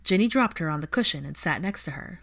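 usual speaking voice

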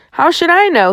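high-pitched, irritated snarl; snarled retort. A narrator's reading. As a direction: throw the question back angrily. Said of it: "he snarled".

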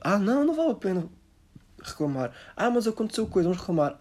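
high whiny voice, lightly mocking. Mocking a resigned compatriot, high and whiny. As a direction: whine it out like a defeated local. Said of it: "nós como portugueses".

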